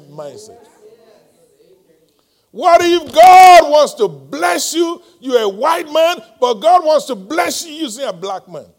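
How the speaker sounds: loud, high preacher's exhortation; full-force preaching. Real, not acted. Preacher urging church not to let race block blessing, full-force preaching. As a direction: drive it home in bursts, stretching key words.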